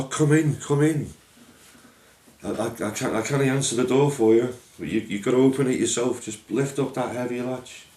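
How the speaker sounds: high, loud call through the door; shouting. Acted. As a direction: call out over the storm to the visitor. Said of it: "So he shouts".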